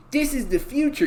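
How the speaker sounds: squeaky parody of a tech fan. Retold or imitated. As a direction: pitch it up in mock awe. Mocking AI shill voice, higher.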